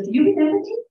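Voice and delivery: high and loud, eager to be recognized; a former patient asking the nurse if she remembers her; eager question. Retold or imitated. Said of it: "she goes".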